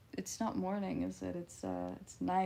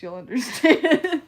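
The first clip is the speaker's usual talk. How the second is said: loud knowing punchline; 4 semitones higher, 14 dB louder than usual. Real, not acted.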